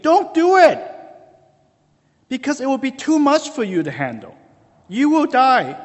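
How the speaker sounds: high, loud acted warning; urgent warning. God warning Adam and Eve off the fruit, urgently. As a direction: cry it out to stop them before it is too late.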